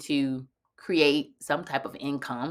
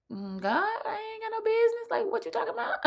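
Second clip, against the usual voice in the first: much higher, laughing disbelief; incredulous; 3 dB quieter than usual. Retold or imitated. Answering the inner voice, high incredulous laugh. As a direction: scoff at the idea through a laugh. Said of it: "I'm like".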